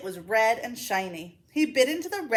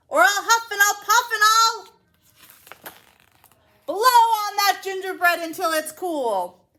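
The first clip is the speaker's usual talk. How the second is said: high, loud wolf demand; growling wolf; 11 semitones higher, 7 dB louder than usual. Acted. Wolf threatening.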